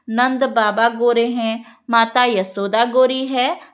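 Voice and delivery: ordinary talk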